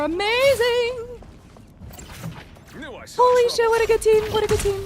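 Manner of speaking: screaming with joy at the win; shrieking jackpot winner. Real, not acted. Team wins the round.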